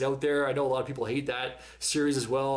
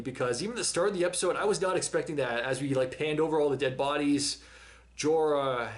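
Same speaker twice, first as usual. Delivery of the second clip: ordinary delivery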